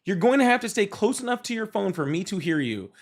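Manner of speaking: usual speaking voice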